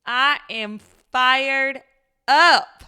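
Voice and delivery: loud, drawn-out high burst with a little twang; fired up. Real, not acted. Warning listeners to turn down the volume, fired up. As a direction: belt it out slowly, punching each word. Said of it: "hear in my voice ... turn down the volume".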